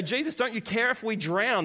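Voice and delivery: high, urgent imitated plea; retold fearful plea. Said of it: "They're afraid for their lives".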